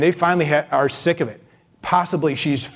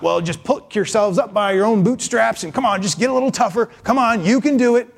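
higher, brisk coach-like cheering on; tough-love encouragement, pitched up; mock pep talk; 6 semitones higher than usual. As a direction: urge them on like a pushy motivator. Mock pep talk to desperate mother.

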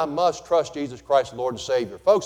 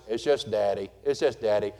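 unimpressed wife; 6 semitones lower, 5 dB quieter than usual. Retold or imitated. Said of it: "the wife goes".

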